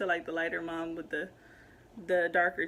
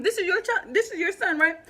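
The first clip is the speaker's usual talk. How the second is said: loud, higher sarcastic challenge; heated challenge; 9 semitones higher, 6 dB louder than usual. Retold or imitated. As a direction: dare her to claim him, loud and high. Challenging the woman about the child.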